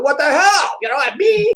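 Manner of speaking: high-pitched retold indignation; indignant. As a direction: protest in mock disbelief at being ignored. Retells his own indignation at being ignored.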